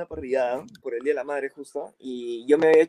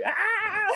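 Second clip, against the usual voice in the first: very high mock shriek; high comic scream; imitated scream. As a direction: imitate a horrified grandmother screaming. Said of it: "y grita".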